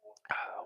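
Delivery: a breathy unvoiced sigh into the mic; ASMR exhale. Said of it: "Was that A S M R for you guys".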